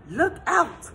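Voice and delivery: a fish shouting a sudden warning; calling out. Acted. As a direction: warn the whole school at once. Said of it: "he called".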